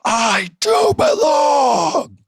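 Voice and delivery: high, slow, loud cry of defiance; a screamed "I do belong", drawn out. Retold or imitated. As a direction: scream it out, drawn long and raw. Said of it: "he screams".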